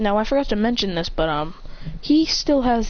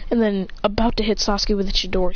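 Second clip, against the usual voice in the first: plain reading voice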